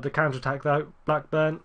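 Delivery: usual speaking voice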